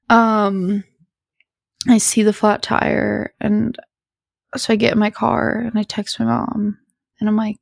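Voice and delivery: ordinary delivery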